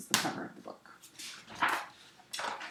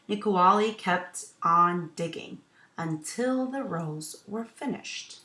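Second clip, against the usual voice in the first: story narration; 2 semitones higher, 7 dB louder, slower than usual. A narrator's reading. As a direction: clear storytelling voice for children. Teacher reads picture book aloud.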